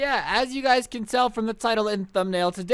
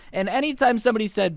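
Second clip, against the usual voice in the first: ordinary delivery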